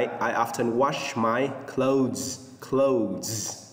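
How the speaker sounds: very slow I often wash my clothes, clothes ending in Z; model for repetition. Shown as a demonstration. Teacher reads the sentence slowly for students to repeat. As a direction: read it slowly so the class can repeat. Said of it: "nhắc lại".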